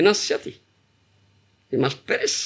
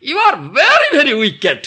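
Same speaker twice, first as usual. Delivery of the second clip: scolding; 6 semitones higher, 5 dB louder than usual. Acted. Scolding the wicked mind.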